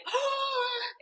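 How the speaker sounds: high, imitated gasp of shock. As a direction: gasp as the stunned best friend. Friend's shocked reaction, retold.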